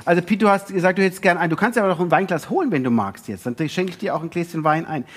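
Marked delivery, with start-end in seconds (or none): none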